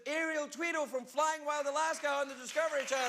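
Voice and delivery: high, projected welcome to a crowd; show host announcement. Real, not acted. Host welcomes the theatre audience back, in a high announcer's call. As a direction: announce it big, drawing out the names.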